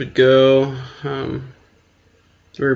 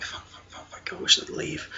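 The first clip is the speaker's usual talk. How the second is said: hushed, voiceless muttering to oneself; under the breath, barely audible; whispered regret; 11 dB quieter than usual. Acted. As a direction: whisper it like a private panicked thought. Acting secret regret beside lover.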